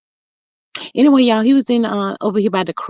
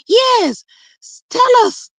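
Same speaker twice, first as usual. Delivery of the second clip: much higher, urging; eager; slower than usual. Real, not acted. Urging a viewer to tell the story, eager. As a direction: beg her to spill the gossip.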